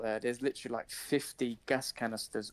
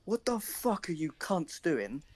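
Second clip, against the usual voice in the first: angry; 5 semitones higher, slower than usual. Retold or imitated. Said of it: "and I'm like".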